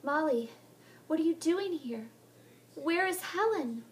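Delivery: high, urgent and alarmed; frantic girl. Acted. Heather waking in the pond, frantic about Helen. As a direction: search for Helen in panic.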